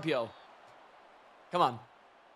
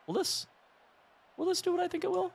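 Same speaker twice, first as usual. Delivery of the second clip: much higher, hushed anticipation; giddy curiosity; 3 dB quieter, faster than usual. Real, not acted. Gamer testing a new blitz, giddy and hushed.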